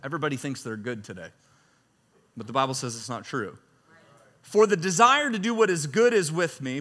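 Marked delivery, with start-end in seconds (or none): 4.4-6.8 raised, declaiming reading voice; scripture reading. Acted. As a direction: read Paul's words like a proclamation. Rereads the verse.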